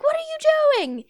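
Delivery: high, shrill-pitched protest; acted outcry; alarmed mother. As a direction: voice the startled mom way up high. Mother alarmed at son's freak-out.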